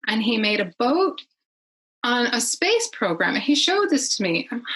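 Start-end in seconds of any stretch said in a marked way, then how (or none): none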